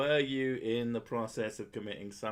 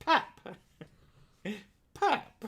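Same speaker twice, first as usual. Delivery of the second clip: high, loud popping pap, pap; loud mock pap sound; 11 semitones higher, 4 dB louder than usual. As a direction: pop the word pap like a sound effect. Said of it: "in America it actually goes".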